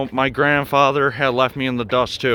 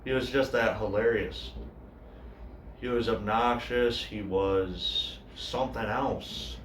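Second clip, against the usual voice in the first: grieving brother's monologue; 2 semitones lower, 15 dB quieter than usual. Acted. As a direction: speak quietly and haltingly, as an addict's brother sharing. Monologue continues about the brother.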